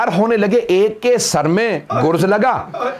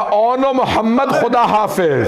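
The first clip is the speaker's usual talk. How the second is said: higher, slow, aching call of the son's name; anguished farewell; 4 semitones higher than usual. Acted. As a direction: say goodbye to a son in anguish. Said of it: "तड़प के कहा".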